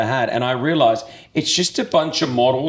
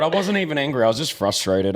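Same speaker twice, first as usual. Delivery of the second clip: ordinary delivery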